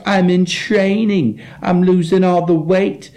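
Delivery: high, drawn-out mimicry of a drunk friend; friend's drunken whine. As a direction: stretch it out high, mocking his drunken boast. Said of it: "That's how he talks".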